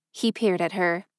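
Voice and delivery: plain reading voice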